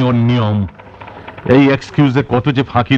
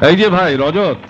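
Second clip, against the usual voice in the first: raised, higher-pitched hail; calling out; 6 semitones higher than usual. Acted. Visitor hailing the office clerk Rajat, calling out. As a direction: call for a colleague's attention.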